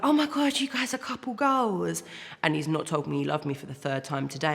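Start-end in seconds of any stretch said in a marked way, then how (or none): 0.0-2.0 high, gushing mock fan squeal; imitating a starstruck commenter, much higher; gushing TikTok fan. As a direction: ham up an adoring fan online. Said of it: "be like".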